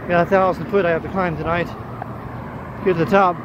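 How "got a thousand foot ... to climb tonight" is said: ordinary talk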